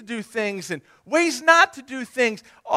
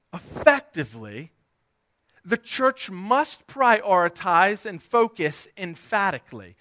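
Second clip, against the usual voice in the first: usual speaking voice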